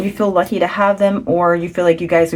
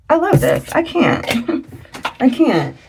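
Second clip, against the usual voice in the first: much higher, gushing and laughing; overjoyed; 6 semitones higher, slower than usual. Real, not acted. Reader overwhelmed with joy at viewers' reading, gushing. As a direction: gush with delight.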